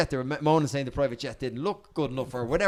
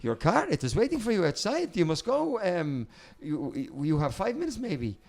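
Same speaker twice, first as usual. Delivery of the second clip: put-on Italian accent; 4 semitones higher, slower than usual. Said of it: "little kind of Italian girl there going".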